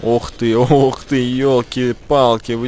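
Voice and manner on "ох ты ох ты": usual speaking voice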